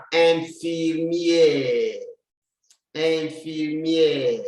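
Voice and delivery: "infirmière" drawn out very slowly; slow model word. Shown as a demonstration. Teacher drilling "infirmière" slowly for the class. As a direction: stretch "infirmière" syllable by syllable. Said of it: "E, come down".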